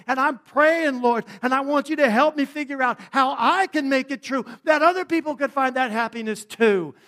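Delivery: loud, high sermon climax; preaching. Acted. Pastor urging the church to pray, full force.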